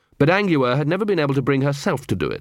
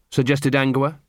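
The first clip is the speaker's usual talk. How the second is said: ordinary delivery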